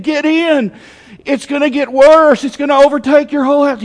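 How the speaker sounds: high, loud rescuer's call; pressing shout from a rescue boat; urgent warning. Acted. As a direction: urge them aboard, loud and high. Voicing second boat's urgent warning.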